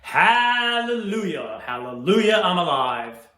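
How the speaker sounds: loud, drawn-out joyful cry; thrilling cry. Acted. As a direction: cry it out in ecstasy. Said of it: "thrilling voice cry out".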